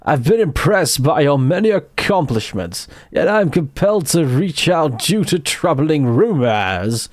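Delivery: slow, grand, booming English gent; Matt Berry voice. Acted. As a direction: read it like a pompous thespian. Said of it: "Channeling my Matt Berry".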